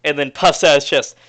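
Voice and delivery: ordinary talk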